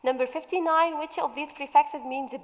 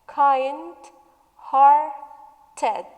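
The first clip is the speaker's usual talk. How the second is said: "Kind-hearted" drawn out as kind, har, ted; three separate beats on "Kind-hearted"; syllable by syllable; 4 dB louder than usual. Shown as a demonstration. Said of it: "So it has".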